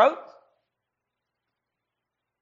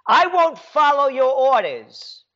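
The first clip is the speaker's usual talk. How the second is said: usual speaking voice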